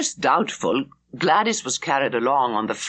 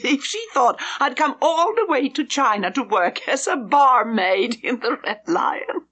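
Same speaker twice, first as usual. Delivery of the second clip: higher, giggling through the joke; laughing; 9 semitones higher than usual. A narrator's reading. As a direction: tease with laughter breaking in. Gladys joking about inn names.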